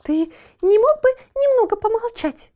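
high, loud, irritated snort. Acted. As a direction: snort it at the noisy puppy. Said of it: "фыркнула кошка".

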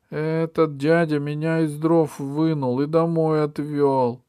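put-on high, small child voice; little girl. A narrator's reading. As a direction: speak like a timid little girl. Little girl's voice. (голосок маленькой девочки)

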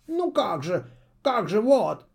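high owl voice, muddled; flustered. A narrator's reading. Confused owl stammers before his mistress. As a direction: lose the thread in confusion. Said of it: "совсем смешался бедный Филин".

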